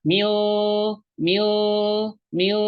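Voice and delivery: "mil" as "mi" with long hidden N; blurred ikhfa. Shown as a demonstration. Teacher drilling ikhfa, "mil" with long blurred N. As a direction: hold each "mil" nasally without closing the N. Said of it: "nun sukunnya kan samar".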